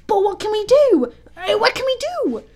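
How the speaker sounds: high, loud helpless wail; panicked cry. A narrator's reading. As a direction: cry out at a loss what to do. Boys panicking at the wolves.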